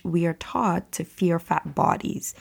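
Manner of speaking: ordinary talk, nothing marked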